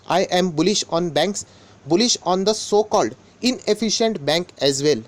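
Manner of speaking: very slow, deliberate quoted statement; quoting word for word. Retold or imitated. Vlogger reading an investor's exact words, very slowly. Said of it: "जो उनके wordings है वही बोलना चाहूँगा".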